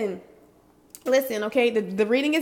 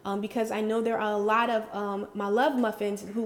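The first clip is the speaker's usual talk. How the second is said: normal talking voice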